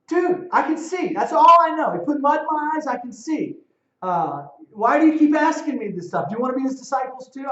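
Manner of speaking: loud, high exasperated sarcasm; sarcastic blind man. Retold or imitated. The healed man mocking the Pharisees, loud sarcasm. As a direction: throw it back at them, fed up. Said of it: "that's the tone he's taking".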